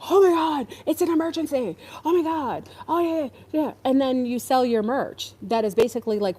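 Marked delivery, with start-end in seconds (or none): 0.1-3.7 high, breathless mock panic; sarcastic fake-alarm voice; mocking alarmist prepper. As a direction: ham up a fearmonger crying emergency. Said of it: "come on here and gaslight people".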